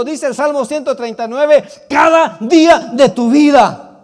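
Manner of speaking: loud, high fiery sermon call; preaching at full force. Acted. Preacher exhorting congregation, loud fiery preaching. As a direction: proclaim it to the whole church.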